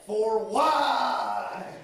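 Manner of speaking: loud, high indignant yell; pissed-off cry. Acted. Pissed off at someone, he yells in a loud indignant cry. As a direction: bark it like you're fed up. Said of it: "when you're pissed off".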